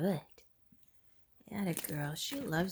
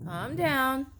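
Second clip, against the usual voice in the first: higher, louder command; firm calm down; 6 semitones higher, 5 dB louder than usual. Real, not acted. Owner firmly telling the excited dogs to calm down. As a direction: raise the voice to settle them.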